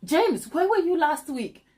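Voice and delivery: a half-serious telling-off; teasing a viewer with mild mock anger; mock mad. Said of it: "Now I'm getting mad".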